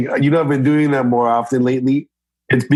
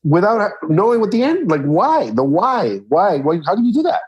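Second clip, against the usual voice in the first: mock innocent questioning; 9 semitones higher than usual. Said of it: "I go".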